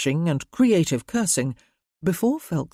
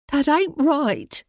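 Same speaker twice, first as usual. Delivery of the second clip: anxious mother; 10 semitones higher, 3 dB louder, slower than usual. A narrator's reading. Mother protests.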